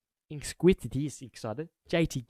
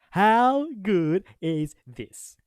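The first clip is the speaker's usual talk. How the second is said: higher, louder and very slow; slow gleeful crow; 6 semitones higher, 7 dB louder, much slower than usual. Real, not acted. Fan savouring the transfer news, slow and loud. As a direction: savour every word like a trophy.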